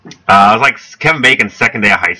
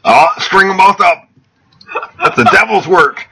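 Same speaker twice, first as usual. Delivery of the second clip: raised-pitch caricature of a Southern local; hammed-up, higher-pitched condemnation; mock small-town bigot; 9 semitones higher than usual. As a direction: join in as a put-on townsperson.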